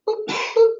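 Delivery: high playful drip noise; mock drip sound. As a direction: make a silly dripping sound.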